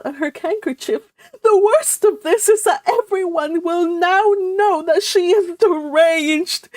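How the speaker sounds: high, loud, tearful lament; lamenting mother. Acted. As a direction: wail it like a mother in despair. Mrs Frederick lamenting.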